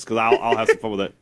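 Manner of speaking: usual speaking voice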